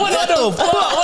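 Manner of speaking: slow, high drawn-out disbelief; shocked. Real, not acted. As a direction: stretch it out in disbelief.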